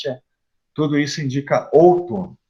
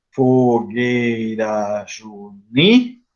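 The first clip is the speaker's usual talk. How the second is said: very slow, syllable by syllable; counting syllables; 4 semitones lower, much slower than usual. Shown as a demonstration. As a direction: stretch each syllable evenly, stopping on the stressed NI. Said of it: "tem cinco sílabas no primeiro verso. Vamos ver se bate". (contando as sílabas, bem devagar)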